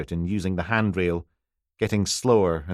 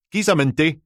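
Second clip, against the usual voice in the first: put-on old Aberdonian man, high and loud; thick Aberdeen brogue, calling out from the door; broad Aberdonian accent; 8 semitones higher, 5 dB louder than usual. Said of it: "Aberdonian accent you could plough fields with".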